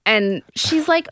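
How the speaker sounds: ordinary talk, nothing marked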